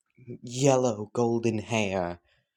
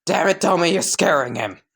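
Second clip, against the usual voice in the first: loud, higher shout; yell; 6 semitones higher, 5 dB louder than usual. Acted. Tattooed man scolding Doma, loud yell. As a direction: snap at him across the room. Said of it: "man would yell".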